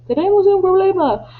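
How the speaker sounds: high, loud mock alarm; alarmed shout. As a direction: re-enact the sailor's alarm cry. Teacher re-enacts Felipe's alarmed cry. (grito de alarma imitado)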